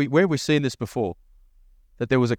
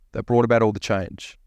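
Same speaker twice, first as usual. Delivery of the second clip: usual speaking voice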